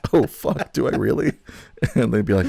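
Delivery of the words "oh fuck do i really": shocked realization. Retold or imitated. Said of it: "I'd be like".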